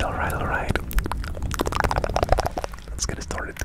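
ordinary talk

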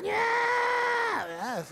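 very high, held dummy yes; squeaky puppet yes. Acted. Ventriloquist's dummy answering in a very high long yes.

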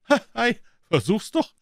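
very high, loud scoffing; mocking boy. Tom daring Huck, high scoffing voice. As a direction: dare him with a scoff.

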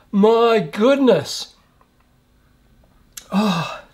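slow, strained pained gasp. Real, not acted. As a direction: drag it out through the burn. Mouth burning from hot sauce.

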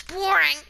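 BORING drawn out and loud; emphatic BORING. Real, not acted. Telling viewers not to watch, emphatic BORING. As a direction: lean hard on BORING. Dismissing the skin pack to viewers.

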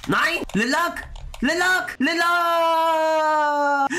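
rising desperate shout of a name; anguished cry. Real, not acted. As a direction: wail his name as he falls. Character dies, crying out his name.

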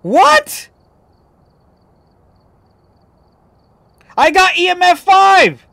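very high, loud yelling; outraged disbelief. Real, not acted. Gamer discovering he missed EMF five, outraged yell. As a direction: scream at the screen in disbelief.

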